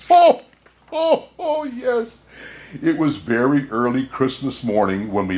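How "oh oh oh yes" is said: delighted laugh. Acted.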